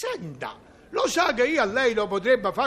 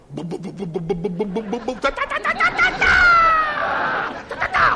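thunder imitation; 5 dB louder than usual. Contestant demonstrating thunder to the teacher, noisy vocal imitation. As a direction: drum up a storm by mouth, then let it explode. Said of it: "Il tuono".

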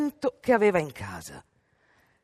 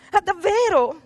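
mother's voice; 13 semitones higher, 6 dB louder than usual. A narrator's reading. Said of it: "disse la madre".

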